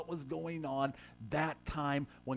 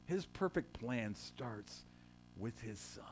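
hushed; 6 semitones lower, 7 dB quieter, slower than usual. Real, not acted. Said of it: "softly closing the point".

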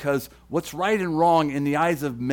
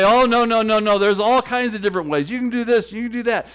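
mock dismissive crowd; 6 semitones higher, 7 dB louder, faster than usual. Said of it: "a lot of people say".

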